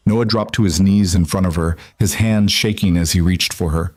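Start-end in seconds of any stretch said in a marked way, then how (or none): none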